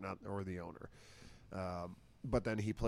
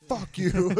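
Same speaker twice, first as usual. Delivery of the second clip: mock retort; 10 semitones higher, 12 dB louder than usual.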